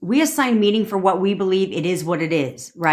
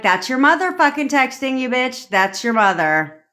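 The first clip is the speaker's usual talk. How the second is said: brash inner voice; 6 semitones higher than usual. Pretend. Voicing the subconscious at a ping.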